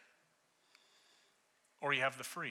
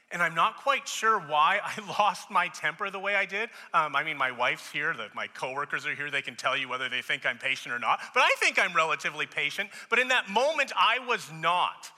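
raised voice, pitch climbing into a shout; worked-up yelling; 5 semitones higher, 10 dB louder than usual. Retold or imitated. Father telling listeners how he lost his temper, loudly worked up. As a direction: retell it as if losing your temper.

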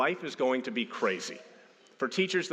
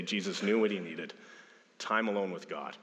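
ordinary talk

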